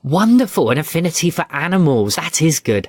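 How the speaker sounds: high, effusive royal approval; delighted queen. Acted. Queen hearing the child wants to keep animals, delighted. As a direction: burst out with pleased praise.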